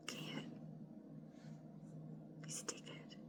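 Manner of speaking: hushed whisper to the camera; whispering about the naughty cat. Real, not acted. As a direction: whisper so the cat won't notice.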